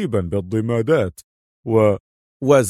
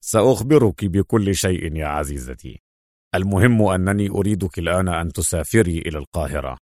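low-pitched, assured hero; self-assured secret agent; 6 semitones lower than usual. A narrator's reading. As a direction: recount the trick in a low voice. (صوت بطل منخفض واثق)

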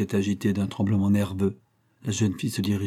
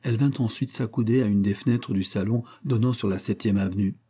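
usual speaking voice

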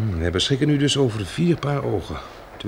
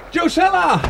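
high, carrying call of her name; slower than usual. Acted. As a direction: shout her name across the dark to her. Calls to her across the dark. (hoog en luid haar naam roepend)

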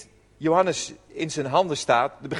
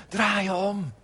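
softer, gentle call to turn around; compassionate plea; 5 dB quieter than usual. Retold or imitated. Jesus calling people back from a bad road, gently. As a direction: plead it kindly, not condemning. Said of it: "vanuit compassie en barmhartigheid".

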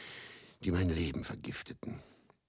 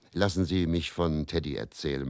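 ordinary delivery

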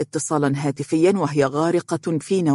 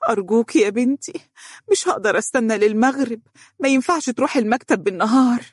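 higher-pitched, imploring voice; pleading; 7 semitones higher, faster than usual. A narrator's reading. Desperate mother begs Dunya for help, pleading. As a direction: beg her not to make you wait. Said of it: "قالت أم فارس برجاء".

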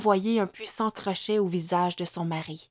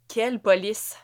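taunting; 2 semitones higher, 5 dB louder than usual. A narrator's reading. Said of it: "nargua-t-elle".

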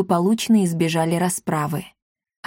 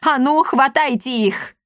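higher, urgent order; imperious outcry, pitched up; roaring command; 6 semitones higher than usual. A narrator's reading. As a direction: order everyone to pounce. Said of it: "громогласный рёв мадам Клеопы".

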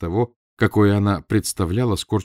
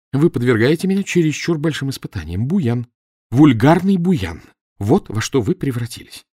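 scolding; 5 semitones higher, 4 dB louder than usual. A narrator's reading. Wife scolds husband.

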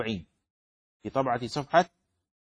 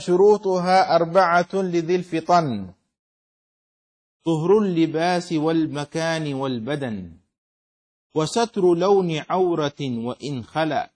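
slow, metrical didactic verse; verse recitation; 4 dB louder, slower than usual. Acted. Teacher reading the poem on prayer conditions, slow measured recitation. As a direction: recite firmly in even meter, drawing out syllables.

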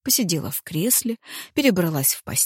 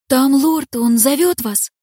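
urgent; 8 semitones higher, 6 dB louder, slower than usual. Acted. Служанка спешно будит.

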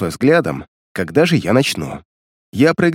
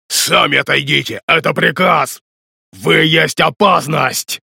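higher, slow crazed undead yell; feral wasteland raider; 5 semitones higher, slower than usual. Acted. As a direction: bark it like a mad creature. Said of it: "Безумно заорал Неспящий".

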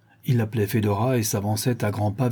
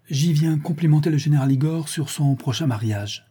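higher, decided answer; resolute; 6 semitones higher than usual. A narrator's reading. Ivan cornered, finding an excuse, resolute. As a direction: declare it with recovered confidence. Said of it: "répondit résolument".

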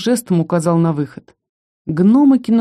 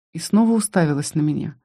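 ordinary delivery, nothing marked

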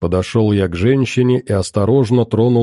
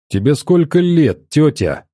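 irritated; 5 semitones higher than usual. Acted. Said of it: "разозлился я".

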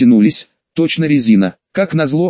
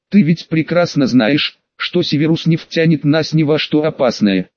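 plain reading voice

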